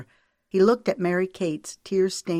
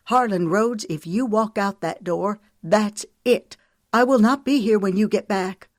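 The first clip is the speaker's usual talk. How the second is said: higher and louder, final ultimatum; wife threatening to leave; furious ultimatum; 5 semitones higher, 5 dB louder than usual. Acted. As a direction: draw the line hard. Ultimatum to her husband.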